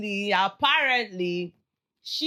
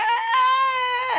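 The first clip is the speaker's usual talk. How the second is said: high mock shriek, raised; 6 dB louder than usual. Retelling a housemate's shriek, mock yelp. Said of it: "She was like".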